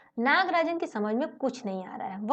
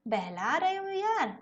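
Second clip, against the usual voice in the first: high, slow incredulous; can't believe he's being given it; slow amazed disbelief, pitched up; disbelieving; 7 semitones higher, slower than usual. Acted. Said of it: "जैसे यकीन ही नहीं हो रहा".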